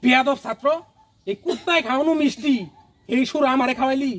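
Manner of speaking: loud, high-pitched rebuke demonstrated for an actor; angry scolding. Shown as a demonstration. Teacher berating a student over dog-tainted sweets, angry scolding. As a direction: bellow it like an outraged teacher.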